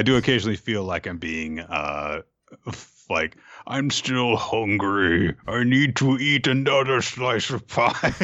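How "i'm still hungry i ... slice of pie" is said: mock hungry American.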